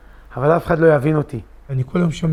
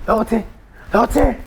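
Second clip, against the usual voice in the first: rising, louder, near-shouting refusal; upset outburst; 9 semitones higher, 3 dB louder than usual. Retold or imitated. To friends at the kollel, an agitated, rising refusal. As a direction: push it higher and higher in frustration. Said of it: "הייתי נסער".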